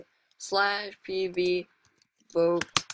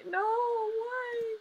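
mock wail. Real, not acted. Getting killed by a friend.

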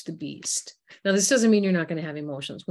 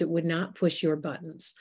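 ordinary talk

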